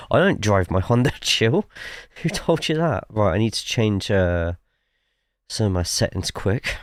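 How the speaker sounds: usual speaking voice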